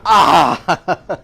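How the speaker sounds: loud retold cry of pain, laughing; mock ouch, laughing through it; pained yelp with laughter. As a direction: yelp as the hammer hits your hand. Hitting own hand retold.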